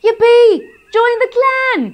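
very high, loud cheer; shout. Acted. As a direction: whoop with joy. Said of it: "the other three shouted".

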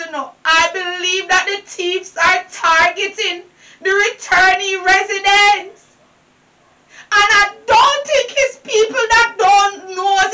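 ordinary delivery

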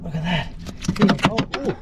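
ordinary talk, nothing marked

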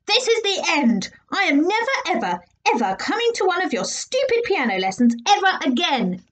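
high furious shout. Acted. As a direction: yell it in defiance. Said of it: "he shouted".

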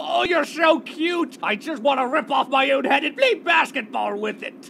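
overwhelmed by cuteness, shout it; gushing shout. Acted. Gushing over cute Pokémon.

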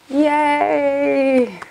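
high, long-drawn yay; celebratory cheer. Real, not acted. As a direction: draw it out in a high-pitched little cheer. Celebratory cheer closing the review.

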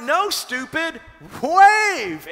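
loud high exasperated shout; exasperated yell. Retold or imitated. Friends on the shore yelling a warning, loud and exasperated. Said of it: "And they're like".

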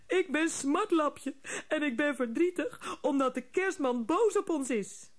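a weepy gnome explaining through tears; mild tearful. A narrator's reading. Sobbing gnome tells the girl why he's sad, mildly tearful. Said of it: "heel zielig te snikken".

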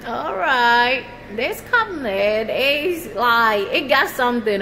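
normal talking voice

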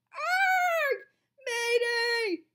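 very high, loud distress shriek; crying out in alarm. A narrator's reading. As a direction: shriek for help as if falling. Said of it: "cried Missus Shorthand".

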